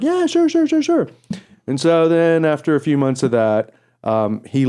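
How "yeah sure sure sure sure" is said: eager agreement. Retold or imitated. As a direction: gush yes like a thrilled fan. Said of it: "I was like".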